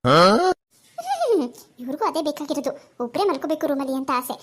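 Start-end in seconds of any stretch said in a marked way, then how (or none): 0.0-1.5 loud, drawn-out huff of annoyance; long huffy hmm. Acted. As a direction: huff loudly to show you're offended. Sulking wife snorts at husband. (ಕೋಪದಿಂದ ಜೋರಾದ ಉದ್ದ ಹ್ಮ್)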